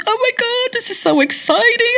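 high, soft gushing excitement; excited mom. Retold or imitated. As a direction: squeal it out like a thrilled mom. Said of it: "She goes".

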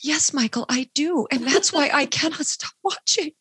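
high, gushing fan reply; mock swooning fan. Viewer answering Michael on the show, swooning and high. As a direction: gush back at the TV. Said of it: "I'd be like".

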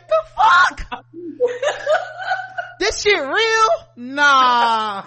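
high, loud disbelieving shout at the dragons; shocked shout. Retold or imitated. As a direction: yell it like you can't believe your eyes. Bron seeing dragons, shouting.

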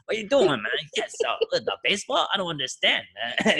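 lightly imitated caretaker, exasperated and baffled; mock a baffled caretaker's scolding; Tiger Hattori impression. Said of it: "trying to do a Hattori voice".

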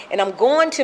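normal talking voice

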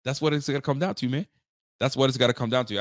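ordinary talk, nothing marked

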